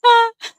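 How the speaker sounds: loud, high laugh; burst of laughter. Real, not acted. Guest tickled by host's slow-dance remark, real loud laugh.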